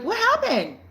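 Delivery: high, loud startled question; shocked question. Retold or imitated. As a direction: blurt it out in alarm. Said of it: "But I'm like".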